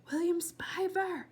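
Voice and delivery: a slow hushed call up to a window; calling a name into the dark, slowly. Acted. Girl calling to a neighbour's window, slow call. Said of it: "she said again".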